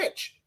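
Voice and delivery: niche said as "nitch"; short i in "niche", rhyming with pitch; everyday pronunciation. Shown as a demonstration. Co-host corrects the other host, "niche" pronounced nitch. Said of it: "Say it like everybody else does".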